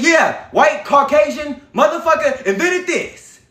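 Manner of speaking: high, slow mock yell; hammed-up boasting yell; mocking shout. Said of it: "They said".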